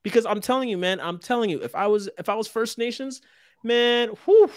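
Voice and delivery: high, worked-up outburst; heated. Real, not acted. To the hosts, high and emotionally worked up. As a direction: let the feeling rise up high. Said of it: "I'm getting emotional".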